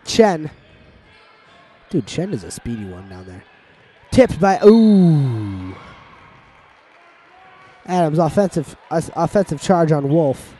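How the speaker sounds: lively live commentary, building excitement; play-by-play. Acted. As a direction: call the game like a sports announcer. Play-by-play resumes.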